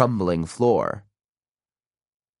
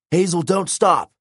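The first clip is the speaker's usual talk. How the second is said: high-pitched, pressing command; urgent order; 8 semitones higher than usual. A narrator's reading. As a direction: order a friend onward in danger. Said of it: "Nico ordered".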